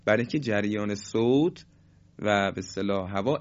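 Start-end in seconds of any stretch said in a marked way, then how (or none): none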